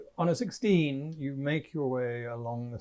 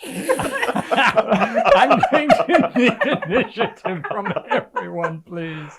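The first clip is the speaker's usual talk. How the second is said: talking through helpless laughter; loud and high, laughing as you get the words out; real laughter; 7 semitones higher, 11 dB louder, slower than usual. Real, not acted. Game master calling the table to roll initiative, laughing.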